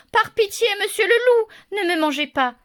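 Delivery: put-on high, pleading little girl's voice. A narrator's reading. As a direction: beg the wolf to spare you. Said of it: "supplie le petit chaperon rouge".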